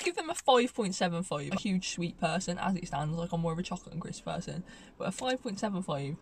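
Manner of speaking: usual speaking voice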